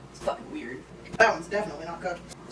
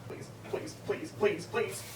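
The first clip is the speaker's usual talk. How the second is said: soft pleading; 9 dB quieter, much slower than usual. Real, not acted. Quiet begging at the stove.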